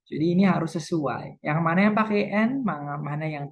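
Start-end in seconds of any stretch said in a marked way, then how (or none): none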